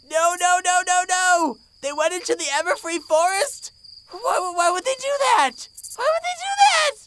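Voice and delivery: rising into a frantic, high-pitched panic; losing it as the fear climbs; panicked. Acted. Fluttershy discovering the girls went into the forest, panicked.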